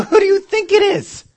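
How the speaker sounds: mock disbelief at dense Gideon; exasperated squeak. Pastor mocking Gideon's doubt, high and incredulous.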